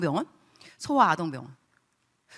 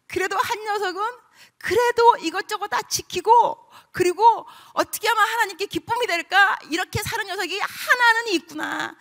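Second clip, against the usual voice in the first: moved exclamation; 9 semitones higher than usual. Pretend. God voiced, moved and rising.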